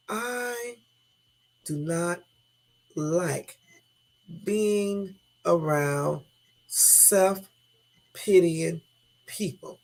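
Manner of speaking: very slow, word by word; deliberate admission. Real, not acted. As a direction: set each word apart with long pauses. Deliberate, emphatic personal admission.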